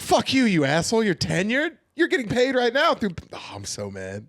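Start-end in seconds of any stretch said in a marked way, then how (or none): 0.0-3.1 high, loud mock yelling; mock furious rant. Comic yelling at the retired NFL player in hammed-up fury. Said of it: "I was like".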